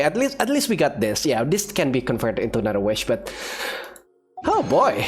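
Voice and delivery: normal talking voice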